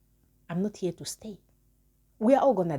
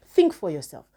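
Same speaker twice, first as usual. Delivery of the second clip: forceful command; 8 dB louder than usual. Real, not acted. Urging elders, commanding burst.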